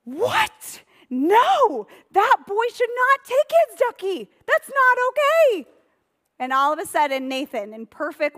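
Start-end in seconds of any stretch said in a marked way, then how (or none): 0.0-5.7 put-on high, loud, indignant child-story voice; shocked protest pitched way up and loud; outraged disbelief. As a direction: squeal it out, scandalised for a kids' audience. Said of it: "David is like".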